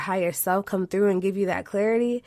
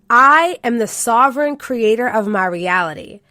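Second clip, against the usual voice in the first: loud, deliberate declaration; affirmation read; 3 semitones higher, 8 dB louder than usual. Shown as a demonstration. Host closing the podcast with an affirmation, slow and loud. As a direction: proclaim it like a vow. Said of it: "So today's affirmation is".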